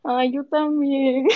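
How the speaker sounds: soft and tearing up; choked with emotion; tearful. Real, not acted. Fan overwhelmed on call.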